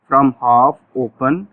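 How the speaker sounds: very slow, each word spaced out; stretched-out classroom dictation; slow drill phrase. Shown as a demonstration. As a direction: dictate it slowly for the class to repeat. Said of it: "देखिएगा जरा".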